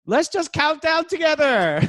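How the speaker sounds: high, loud rallying call; rally the crowd to join in; forced enthusiasm. Retold or imitated. Reenacts desperate closer to audience.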